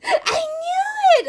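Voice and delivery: high, drawn-out squeal of triumph; excited mock squeal. Excitedly voices Beth's told-you-so.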